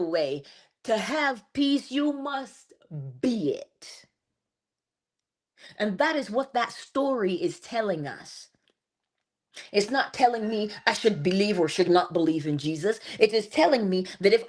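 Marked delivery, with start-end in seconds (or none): none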